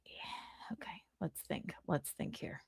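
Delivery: soft unvoiced whisper while thinking; quietly whispering as she ponders; whispering to self. Real, not acted. Thinking through the answer.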